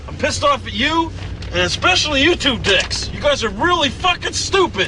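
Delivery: angry yelling. Acted. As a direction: tear into them, fed up. Furious at friends after shootout.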